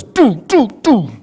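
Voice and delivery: loud, high "do" thuds, one by one; imitated head banging. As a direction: mimic a forehead banging on a desk. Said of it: "this guy goes like this".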